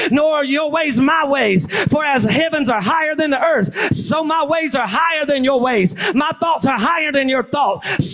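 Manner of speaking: high, shouted pulpit cadence; full-force preaching. Acted. Preacher citing scripture on God's ways, high impassioned preaching. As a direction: proclaim the scriptures in an unbroken, driving run.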